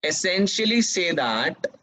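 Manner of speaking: very slow, each phrase repeated; teacher dictating notes word by word; slow dictation. Shown as a demonstration. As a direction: dictate the definition for students to copy. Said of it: "dictating second definition".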